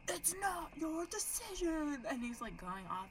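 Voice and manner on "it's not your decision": high, drawn-out wail, imitated; upset teary guy. Retelling the neighbor going off on his friends, high tearful whine. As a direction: mimic a man scream-crying. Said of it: "he's talking like this".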